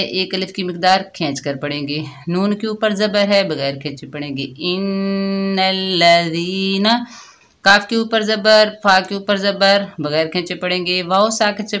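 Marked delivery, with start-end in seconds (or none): 4.7-7.1 Quranic recitation. Acted. Recites the word.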